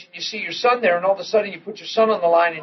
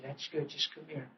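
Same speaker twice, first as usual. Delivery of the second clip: much softer, near-whispered soothing; hushed comfort; 3 semitones lower, 20 dB quieter than usual. Retold or imitated. Father rocking his son after he can breathe, hushed. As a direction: hush him close and gentle.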